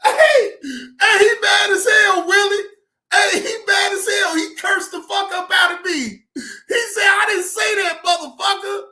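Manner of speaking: high, loud, amused hollering; tickled shouting. Real, not acted. Streamer tells listeners he got cursed out, tickled shouting. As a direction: holler it on the edge of cracking up. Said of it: "I was able to laugh".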